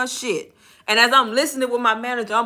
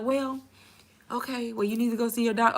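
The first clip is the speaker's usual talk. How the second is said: soft, slightly raised voice; HR staffer; 8 dB quieter than usual. Retold or imitated. HR telling agent to see a doctor, softly. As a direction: quote an official, hushed and hesitant. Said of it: "they was like".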